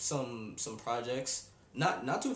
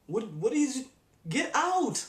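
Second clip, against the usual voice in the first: much higher, louder get out; incredulous shout; 8 semitones higher, 5 dB louder, slower than usual. Real, not acted. Reacting to the slang story, higher louder incredulous shout. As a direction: burst out in disbelief. Disbelieving outburst.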